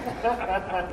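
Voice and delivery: a soft, breathy little laugh after the joke; soft chuckle. Real, not acted. Preacher laughing softly at his own title pun. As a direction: chuckle quietly at your own wordplay.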